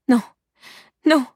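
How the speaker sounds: high, loud, frightened cries; panicked pleading. A narrator's reading. Terrified woman sobbing by the sheepfold, panicked. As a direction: cry out in terror, each word on its own. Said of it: "répétait-elle entre deux hoquets affolés".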